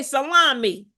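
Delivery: usual speaking voice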